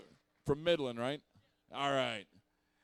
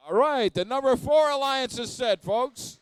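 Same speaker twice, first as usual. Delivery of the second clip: game announcer; 9 semitones higher, 15 dB louder than usual. Real, not acted.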